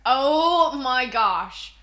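usual speaking voice

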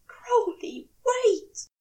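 high cry rising to a loud wait; begging cry. A narrator's reading. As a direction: call after him in desperation. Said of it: "he begs".

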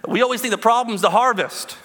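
retold pastor. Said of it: "And he said".

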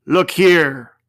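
ghost of Christmas Present. A narrator's reading. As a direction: speak as an aging ghost, grave and full. Ghost shows the children.

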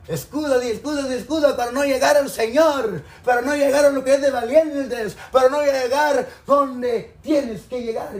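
loud, rising sermon cry; fiery preaching. Real, not acted. Preaching at full force.

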